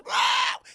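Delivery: loud breathy shriek as she leaps; attack shriek. Acted. Feces-covered woman pouncing on Alice, loud breathy shriek. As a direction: hiss out a wild attack cry.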